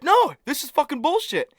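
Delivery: high-pitched retold outburst of indignation; imitating pissed off. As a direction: act out your friend losing his temper. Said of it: "actually getting pissed".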